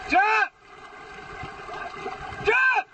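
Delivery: very high yelled calls; shouted commands. Real, not acted. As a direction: yell it out, high and sharp. Calling out during cliff jump.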